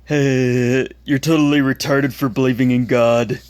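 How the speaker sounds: taunting schoolyard bully voice; mocking bully. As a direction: sneer it like a bully. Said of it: "the ones that I, I quoted".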